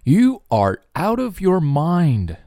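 mocking disbelief; incredulous. Shown as a demonstration. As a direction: scoff at a crazy idea. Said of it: "你呀，脑子有病吧".

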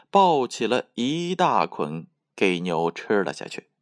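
ordinary delivery

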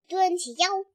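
ordinary delivery, nothing marked

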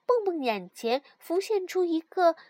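ordinary delivery

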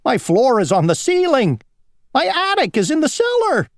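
very high, squealing dismay; panicked cry. A narrator's reading. Worm inside its rolling house, high frantic wail. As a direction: wail each mishap in a high voice. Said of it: "cried the worm".

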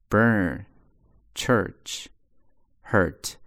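burn church hurt, curled r again; curled r vowel, repeated. Shown as a demonstration. Teacher repeats the American er sound. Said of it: "所以在卷舌头啊".

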